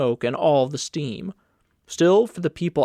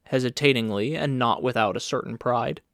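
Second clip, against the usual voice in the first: ordinary delivery, nothing marked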